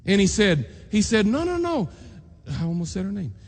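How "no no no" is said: put-on child protesting. Boy John protests to teacher.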